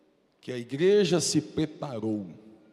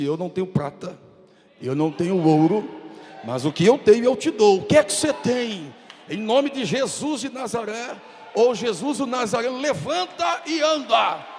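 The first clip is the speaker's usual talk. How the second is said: Peter commanding healing; 9 semitones higher, 8 dB louder than usual. Acted. Peter at the temple gate ordering the lame man to walk, a roaring shout.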